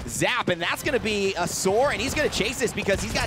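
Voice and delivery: loud, high hype play-by-play; esports caster. Real, not acted. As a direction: call the play at full volume. Live play-by-play.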